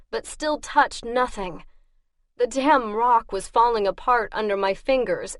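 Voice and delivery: ordinary delivery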